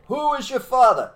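teacher's call. Retold or imitated. Soviet teacher quizzing schoolkids, high and loud. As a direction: ring out the question to the class. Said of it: "They said".

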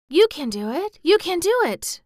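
high, loud fervent chant; will him on with rising urgency; eager girl's private cheer; urging to herself. A narrator's reading. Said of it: "said Mary to herself".

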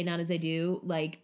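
usual speaking voice

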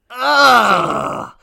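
loud drawn-out groan of disgust; loud disgusted ugh. Real, not acted. Groan at lawyer news.

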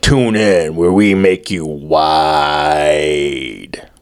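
slow, drawn-out promo sinking low on the last word; mock network announcer. Host spoofing a BBC network promo, mock announcer. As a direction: stretch it out like a station promo, dropping deep.

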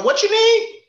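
high and loud shop clerk. Retold or imitated. Loud store clerk mocked.

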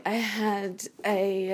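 usual speaking voice

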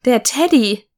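put-on child recognizing her teddy, pleased; delighted guess. A narrator's reading. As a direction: guess it with a spark of joy. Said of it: "riet ich und freute mich".

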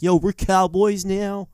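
slow, high, loud mock cowboy drawl; a northerner badly faking a cowboy accent; hammed-up bad cowboy twang; wannabe cowboy accent. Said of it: "trying to be cowboys".

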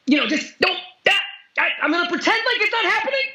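high, fast mocking squeak; mocking silly voice. Mocking someone ignoring pain.

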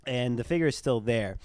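ordinary delivery

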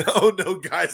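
normal talking voice

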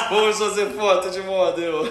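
laughing through the words; giggling invitation. Real, not acted. Host laughing at pose.

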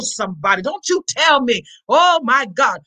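ordinary talk, nothing marked

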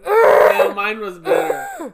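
loud high shout. Real, not acted. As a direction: yell it out in protest. Loud competitive protest.

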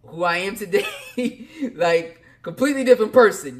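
laughs it out, loud and high; laughing. Real, not acted. To viewers, laughing about who he used to be. Laughing at his younger self.

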